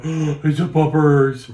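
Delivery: slow, loud, squealing over a dog; excited puppy voice. Retold or imitated. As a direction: gush over the puppers. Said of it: "a certain follower on your page that's gonna go".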